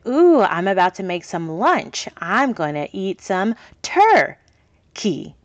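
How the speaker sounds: turkey said slowly with a pause; I'm gonna eat some tur... key, slowed and split in two; pause in between. Shown as a demonstration. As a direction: break turkey into its two parts for a child. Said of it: "Put that little pause in between".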